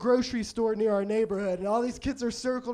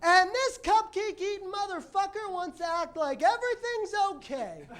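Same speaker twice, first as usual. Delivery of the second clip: high mock-angry woman voice, fading into a laugh; ranting woman; 8 semitones higher, 3 dB quieter than usual. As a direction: imitate the pigeon lady insulting a passerby. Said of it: "this woman goes".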